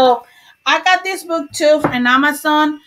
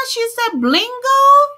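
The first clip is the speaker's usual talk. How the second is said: surprised; 9 semitones higher, 4 dB louder than usual. Real, not acted. Surprised at bingo call.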